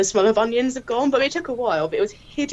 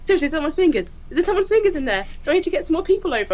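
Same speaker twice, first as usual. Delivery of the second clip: anxious; 9 semitones higher, much faster than usual. Retold or imitated. PCSO anxiously asking.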